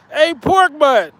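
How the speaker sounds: teasing. Real, not acted. Teasing Reg as a pig.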